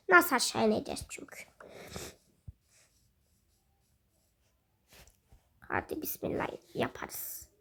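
hushed whispered worry, quick; nervous whispered bismillah. Real, not acted. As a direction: whisper it as if scared to fall. Nervous descent in game.

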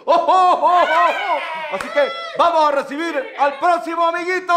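hearty ho-ho-ho into a big call; Santa Claus. Acted. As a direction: announce the next child, stretching the end. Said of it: "yo soy Santa". (Santa festivo, pregonando)

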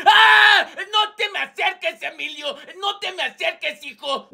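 high scared yelling that fades; panicked shout. Acted. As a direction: recoil in fear. Profe asustado otra vez.

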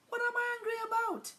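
high, quick squeal of a question; high-pitched feigned puzzlement; mock innocence. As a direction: ask it very high and fast. Mock-innocent question after saying angry.

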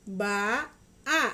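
Ba and a each said loud and slow, apart; over enunciating. Shown as a demonstration. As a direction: sound out each syllable, Ba then a. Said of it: "ikutin Mama ya".

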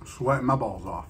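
chewing while talking; talking around a bite; mouth full. Real, not acted. Seen 2: Bites at -5, cheeks full and chewing while speaking, sandwich in hand.

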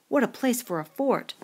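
put-on a boy thrilled with his find; excited. A narrator's reading. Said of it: "Jack was excited".

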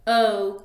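loud drawn "o"; long O. Shown as a demonstration. Teacher blending sounds for the class. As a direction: sing out the long o. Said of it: "that long O".

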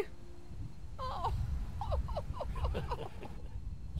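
long, high, quiet laughing fit; soft, high, wheezy giggling; helpless laughter. Real, not acted. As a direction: crack up at your own silly voice. Cracks up at own accent.

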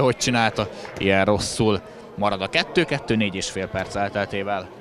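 ordinary delivery

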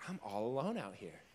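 low, soft, frightened; small scared voice alone at night. Retold or imitated.

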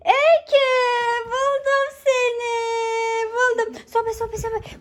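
high excited call, found you. Real, not acted. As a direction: call out with a thrill of finding her. Found child in hide and seek.

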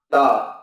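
"tờ" short and unreleased; final t sound. Shown as a demonstration. Teacher demonstrating the final-position "tờ". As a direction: clip "tờ" short. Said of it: "ở cuối từ ta phát âm là".

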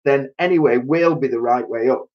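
proud of his idea; eager plan. A narrator's reading. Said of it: "cried Mister Twit".

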